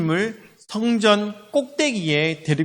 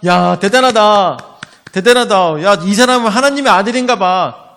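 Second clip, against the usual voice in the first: loud, acted exclamation of awe; amazed crowd; 6 dB louder than usual. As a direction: exclaim like an impressed onlooker.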